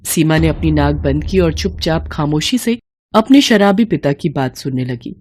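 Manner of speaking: ordinary delivery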